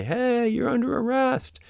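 high, drawn-out mock yell; calling out. Officer to someone across a cornfield, mock yelling. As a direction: call it out high, stretching the words. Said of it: "you yell out and you say".

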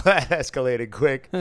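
laughing, loud amused remark. Retold or imitated. Amused rider laughs at the train fight. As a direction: chuckle it out amazed. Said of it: "I was like".

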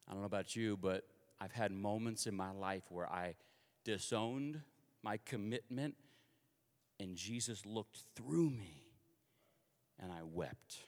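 low, soft, halting admission; subdued confession. Real, not acted. Preacher admitting he disowned his commitment, subdued.

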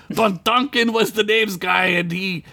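high, defensive protest; exasperated, breaking off abruptly; yelled. A narrator's reading. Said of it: "Gunk yelled".

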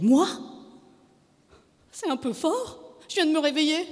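high-pitched protest; indignant wife. A narrator's reading.

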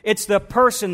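normal talking voice

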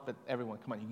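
ordinary delivery

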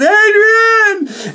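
high, strained call across the ring; yelling Adrian. Retold or imitated. Rocky calls for his girlfriend after the fight, high yell. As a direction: call out for her, desperate.

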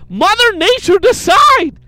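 high, drawn-out comic yell; yelled rant. Pretend. Co-host rails about Mother Nature, slow high yell. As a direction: stretch it out loud like an outraged ranter. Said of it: "thank you for yelling".

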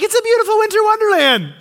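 high, fast and loud with wonder; bright burst of mock rapture over the snow; gushing delight. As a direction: gush about the snow like a delighted kid. People viewing the same snow.

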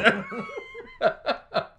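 sudden high laugh; high squeal laugh. Real, not acted.

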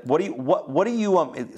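mildly exasperated, stumbling over words; irritated. Acted. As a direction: act out Moses losing patience a little. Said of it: "now Moses is getting irritated".

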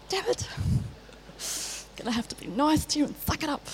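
soft, fast, reluctant resignation; grumbling under your breath, giving in; grudging mutter. Retold or imitated. Youth worker to herself after the teen's jab, soft fast grudging grumble.

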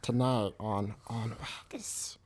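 hoarse whispered creature voice; Gollum voice. Acted. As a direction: rasp it out like Gollum. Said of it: "He's about to do the Gollum voice".

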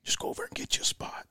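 hushed, offhand instruction; whispering. Retold or imitated. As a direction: whisper it casually. Hunting partner voiced, hushed.

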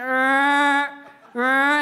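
loud croaking frog call. Retold or imitated. Imitating the frog calling its partner, loud croak. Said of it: "it got louder and louder".

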